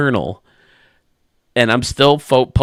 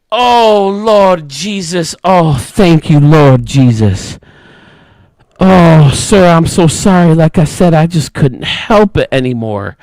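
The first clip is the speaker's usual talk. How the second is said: louder, higher, drawn-out Lord Jesus of relief; loud relieved cry; 5 semitones higher, 7 dB louder than usual. Retold or imitated. Old lady finally relieving herself, loud and thankful. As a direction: cry out to the Lord like a great weight just lifted.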